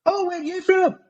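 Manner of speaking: put-on very high-pitched Scottish accent; Scottish girlfriend. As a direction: mimic a Scottish woman in a squeaky-high voice. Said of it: "and she's like".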